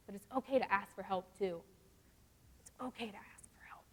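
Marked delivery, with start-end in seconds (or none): 2.6-3.9 whisper. Real, not acted. Whispered after the spoken line.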